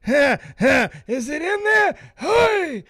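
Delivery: high, loud, panting mock voice; hammed-up panting lover voice.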